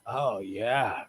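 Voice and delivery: low breathy mock moan; mock seduction, hammed up; mock sultry.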